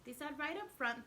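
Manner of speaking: ordinary delivery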